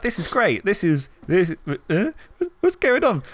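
watching a show turn weird, bewildered; rising disbelief. Retold or imitated. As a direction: go from delight to baffled. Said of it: "I was like".

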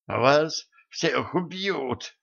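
slow breathless rasp; gasping hoarse. Acted. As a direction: wheeze it out, short of breath. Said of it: "задыхаясь, прохрипел".

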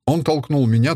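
ordinary delivery, nothing marked

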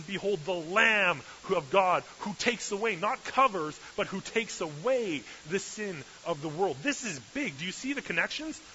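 fired-up pulpit delivery; preaching at full force. Acted. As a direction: preach it out, rising to the point. Preaching the Lamb connection.

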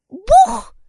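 put-on very high, loud childish 'boom'; imitated thud. A narrator's reading. Little child mimics boy's fall, squeaky thud. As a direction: act out the crash in a little kid's squeal. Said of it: "изобразила другая малышка".

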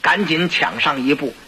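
usual speaking voice

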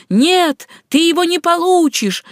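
high, loud, defiant cry; taunting shout. Acted. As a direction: yell it back while fleeing. Стряпчий дразнит черта. (дерзкий громкий выкрик)

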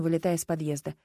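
usual speaking voice

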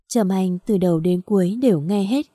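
ordinary delivery, nothing marked

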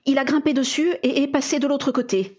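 defensive. A narrator's reading. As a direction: justify yourself as the accused mother. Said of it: "nettement sur la défensive".

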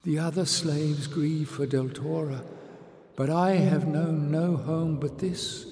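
put-on a lonely young girl.

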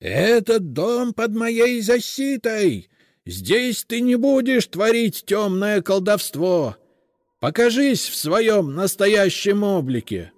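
slow, high, loud eldritch wail; inhuman howl. A narrator's reading. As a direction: howl it like an ancient spirit. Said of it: "завывал нечеловеческим голосом".

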